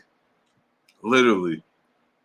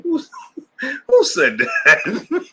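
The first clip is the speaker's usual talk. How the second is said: much higher, very slow, laughing between the words; slow mock-baffled "who, who said that"; imitating her; much slower than usual. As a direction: drag out her baffled question. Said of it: "She said".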